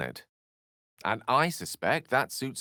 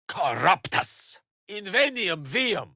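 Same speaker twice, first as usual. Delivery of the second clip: spell incantation; 10 semitones higher, slower than usual. Acted. Casting a spell.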